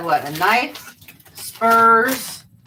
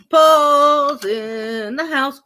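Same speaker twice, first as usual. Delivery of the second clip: announcer; 4 semitones higher, much slower than usual. Pretend. Owner repeating her dog's entrance, slow hype announcement. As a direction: stretch it out like a ring announcer.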